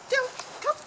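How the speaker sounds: high sing-song call; calling a dog. Real, not acted. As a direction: call the puppy back brightly. Calling puppy back.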